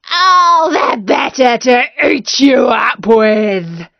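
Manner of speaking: the wolf about to pounce, slow and loud; hammed-up wicked wolf; menacing wolf.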